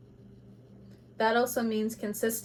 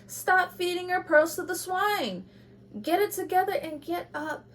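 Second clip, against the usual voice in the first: higher, sharp scolding; 9 semitones higher than usual. Real, not acted. Reader scolding Libra to stop comparing, sharp. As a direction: scold them to get it together. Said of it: "I know I'm being sharp".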